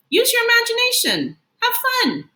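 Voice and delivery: pep up the class; upbeat teacher character voice; cheery teacher. Acted.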